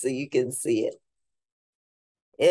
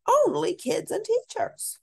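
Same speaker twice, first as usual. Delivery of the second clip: much higher with rising end; high child voice. Acted. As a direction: repeat the rule in the kid's voice again. Child voice repeating the rule.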